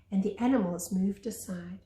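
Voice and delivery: usual speaking voice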